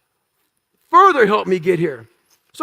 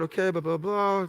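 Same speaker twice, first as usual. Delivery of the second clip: much softer, trailing mock murmur; mumbled blah blah; 4 semitones lower, 12 dB quieter than usual. Imitating the recruiter muttering through forms, much softer. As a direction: mumble it like busy paperwork talk.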